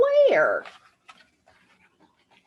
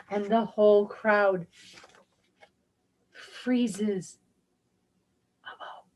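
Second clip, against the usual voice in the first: ordinary delivery, nothing marked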